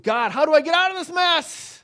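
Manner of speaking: high, loud pleading voice; desperate cry. Retold or imitated. Believer stuck in a mess crying loudly to God. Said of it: "we're get".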